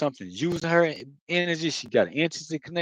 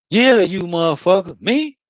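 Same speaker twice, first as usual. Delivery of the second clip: high, louder incredulous snap back; mock angry retort; 4 semitones higher, 7 dB louder than usual. Retelling the YouTube woman's threat, he fires back incredulous and loud. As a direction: hurl it back in disbelief. Retelling a shouting match online.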